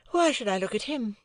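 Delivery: put-on cool, airy society girl; Estella. Estella voiced, higher.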